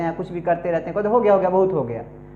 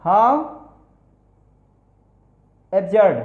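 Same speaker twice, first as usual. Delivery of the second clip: word by word; 4 dB louder, much slower than usual. Shown as a demonstration. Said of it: "how / absurd, slow word by word".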